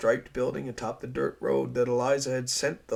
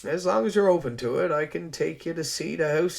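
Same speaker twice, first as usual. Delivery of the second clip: louder, cheerful Rogers; amused island man; 4 semitones higher, 7 dB louder than usual. Acted. Rogers offering to show Eliza the house, amused. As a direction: reassure her with a smile.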